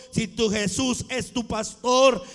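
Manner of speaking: ordinary talk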